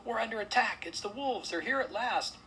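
high, loud alarm; panicked cry. A narrator's reading. Lord warning guests of a wolf attack, panicked cry. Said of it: "cried Lord Sudsbury, his tail a-tremble".

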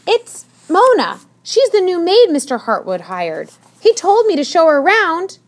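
high, loud, insistent explanation; defensive explaining. A narrator's reading. Tilly introduces the new maid, defensively.

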